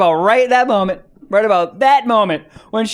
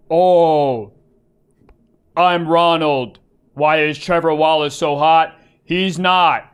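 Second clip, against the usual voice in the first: spirit voice; 4 semitones lower, slower than usual. Retold or imitated. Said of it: "the fucking spirit goes in".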